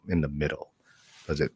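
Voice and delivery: ordinary talk, nothing marked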